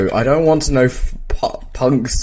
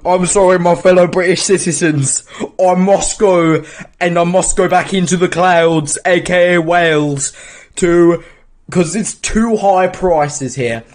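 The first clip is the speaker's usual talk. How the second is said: high, loud mock royal address; mock regal Jesus speech; 6 semitones higher, 4 dB louder than usual. Friend voices Jesus addressing British citizens in a mock speech. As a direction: deliver a hammy farewell speech. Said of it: "Jesus is like".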